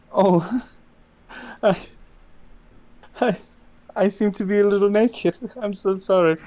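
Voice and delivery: high, halting embarrassed character; apologetic Vendela. Acted. Game master plays embarrassed Vendela.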